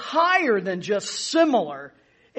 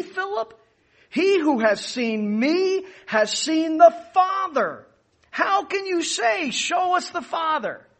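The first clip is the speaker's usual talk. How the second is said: Jesus rebuking; 6 semitones higher than usual. Retold or imitated. Jesus rebukes Philip, quoted.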